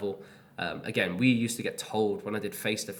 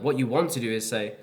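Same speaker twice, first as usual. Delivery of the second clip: ordinary talk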